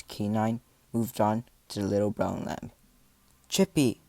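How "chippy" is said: loud, higher shout of a name; alarmed call. A narrator's reading. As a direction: call out urgently to the lamb. Calling out to endangered lamb.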